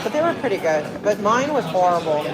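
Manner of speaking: normal talking voice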